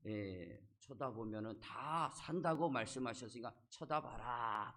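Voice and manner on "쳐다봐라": drawn-out, retold call to look. Moses calling to the dying Israelites to look, mildly shouted. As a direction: call it out slowly, a mild mock shout. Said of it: "소리를 쳤어요".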